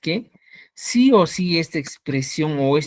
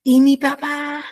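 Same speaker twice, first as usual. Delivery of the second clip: ¿Y mi papá? pitched much higher and a little slower, with mi stressed; exaggerated question; 10 semitones higher, 4 dB louder, slower than usual. Shown as a demonstration. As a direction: overplay the question tune on ¿Y mi papá?. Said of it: "entiende esto". (pregunta exagerada, muy aguda: ¿Y mi papá?)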